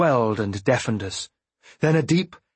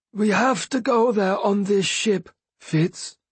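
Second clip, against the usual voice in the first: higher, changed voice for the Fool; the Fool's voice; 9 semitones higher than usual. Acted. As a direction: drop the mask, speak as the Fool. The Fool's own voice returns.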